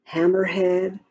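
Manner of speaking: ordinary delivery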